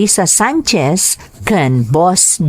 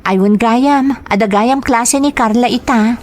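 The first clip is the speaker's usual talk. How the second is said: put-on higher, earnest young daughter asking her father; 5 semitones higher than usual. Daughter asking her father where he works, higher voice. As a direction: play the worried daughter, pitched up.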